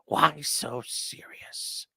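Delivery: slow creepy whisper; whispered Joker line. Retold or imitated. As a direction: breathe it slowly like the Joker. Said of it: "and he said".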